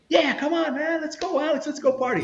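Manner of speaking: high-pitched, eager informant voice; eager party-goer. Retold or imitated. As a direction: imitate a pal egging everyone on to party. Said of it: "the C I is like".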